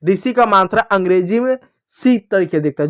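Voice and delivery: normal talking voice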